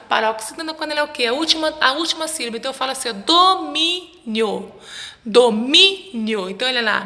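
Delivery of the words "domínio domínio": domínio very slowly, mí stretched and stressed; syllable-by-syllable stress. Shown as a demonstration. Teacher shows children the strong syllable, domínio drawn out on mí. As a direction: break domínio into syllables and lean hard on mí.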